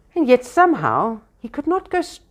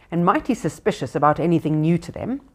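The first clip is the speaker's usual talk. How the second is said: ordinary delivery, nothing marked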